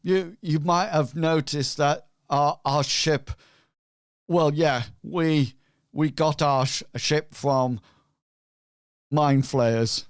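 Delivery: loud, halting character voice; non-player character. Acted. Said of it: "he says".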